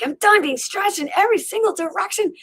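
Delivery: high, strained outburst of frustration; escalating rant. Retold or imitated. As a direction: vent at the end of your rope. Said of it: "my mind's escalating".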